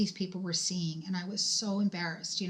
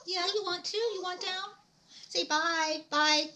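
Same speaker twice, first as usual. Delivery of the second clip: high cutesy pet-owner coo; 11 semitones higher than usual. Real, not acted. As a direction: sweet-talk the pup in a high voice. Talking to her dog.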